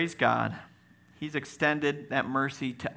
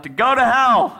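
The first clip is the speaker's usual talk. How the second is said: high, loud shout from the crowd; blurted-out mock shout; imitated yell; 11 semitones higher, 12 dB louder than usual. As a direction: yell it out like an eager youth answering. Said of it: "one of them yells out".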